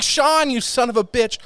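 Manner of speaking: high, delighted teasing greeting; affectionate mock insult. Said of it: "I was like".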